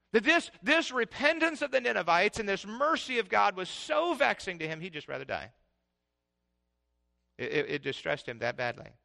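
ordinary talk

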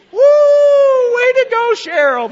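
very high, loud whoop; cheering ovation. Retold or imitated. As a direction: whoop and cheer as she makes it. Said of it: "an ovation and whistling".

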